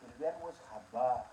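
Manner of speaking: usual speaking voice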